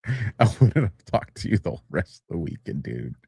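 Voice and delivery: normal talking voice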